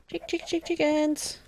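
high sing-song call to chickens. Real, not acted. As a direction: coax the chickens over, high and sweet. Calling chickens in Minecraft.

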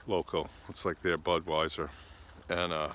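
ordinary delivery

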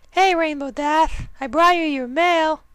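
very high cheerful pony voice; bright and goofy, way up high; Derpy voice. Acted. Derpy answers Dash.

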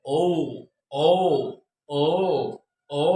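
"ও" drawn out as a round oh-oo, four times; long O drill. Shown as a demonstration. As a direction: stretch each "ও", pausing between them. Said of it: "উচ্চারণ করা শিখতে হবে".

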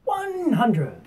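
high, loud drawn-out darts caller's call; referee voice. Shown as a demonstration. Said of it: "get our referee voice on".

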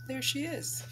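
ordinary delivery

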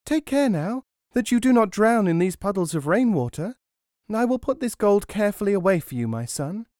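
high, fast fussing mother. Acted. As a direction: fuss over him like a doting mother. Mother talks to her son.

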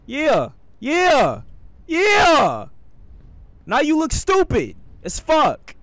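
high, loud gloating cries, each falling away; taunting yells. Real, not acted. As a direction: crow over a beaten enemy. Taunting enemy after skip.

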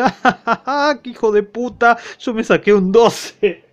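taunting, laughing through the words; mocking laugh. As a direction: laugh at him as you gloat. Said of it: "se burla y dice".

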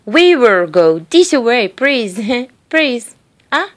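light imitation of a Japanese accent, R and L swapped; mock Japanese speaker mixing up R and L sounds. Speaker imitates Japanese people swapping sounds, lightly accented. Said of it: "but they just say in the opposite".

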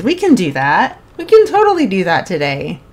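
normal talking voice

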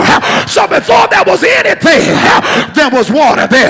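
ringing call-and-response sermon; preaching. Acted. Pastor leading congregation call and response, rousing preaching. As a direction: drive each point home, then call for the answer.

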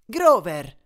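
loud, sharp reproach; exasperated call, falling at the end; scolding. A narrator's reading. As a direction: scold him by name, loudly. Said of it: "lo rimproverò Annabeth".

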